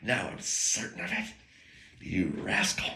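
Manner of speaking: soft, unvoiced gunslinger line; whispered cool menace; Doc Holliday. Quoting the movie line again, soft and whispered. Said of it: "I had a Doctor Reed moment".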